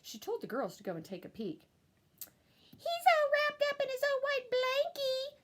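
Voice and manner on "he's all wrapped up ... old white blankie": put-on much higher girl's voice; higher child's voice reporting; little girl voice. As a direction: tattle like a little sister. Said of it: "said Jezebel".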